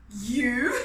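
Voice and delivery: loud scoffing question; mocking lion. King Lion mocking Anansi, scoffing question. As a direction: scoff at the little spider. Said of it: "laughed King Lion".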